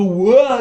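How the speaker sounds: hammed-up surprised whoa; mock whoa. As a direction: yelp it as if knocked back. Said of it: "You go".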